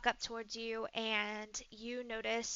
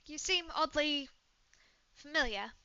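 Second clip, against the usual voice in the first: British woman, curious; 4 semitones higher than usual. Acted. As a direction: puzzle over a familiar face, British-accented.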